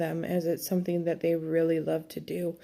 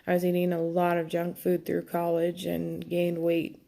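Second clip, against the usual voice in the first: ordinary talk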